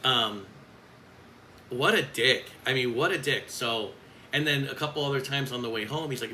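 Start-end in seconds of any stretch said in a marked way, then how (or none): none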